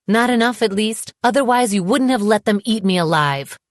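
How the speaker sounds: high, forceful reproach; bitter accusation. A narrator's reading. Woman blaming her ex for not protecting her, bitter.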